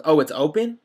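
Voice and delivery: loud, high, mocking surprised question; imitating a surprised customer; mock surprise. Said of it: "she goes".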